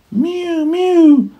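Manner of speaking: high mew mew, like a cat at the door; high plaintive cat mew. A narrator's reading. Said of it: "interrupted Simpkin".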